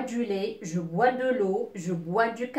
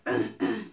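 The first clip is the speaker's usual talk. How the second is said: short demonstrative cough; toux simulée; 2 semitones lower, 3 dB quieter than usual. Shown as a demonstration. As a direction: cough lightly to show the verb. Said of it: "on tousse". (petite toux de démonstration)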